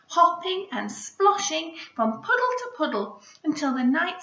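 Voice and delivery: story narration. A narrator's reading. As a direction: make the ending burst with wonder. Reading story aloud.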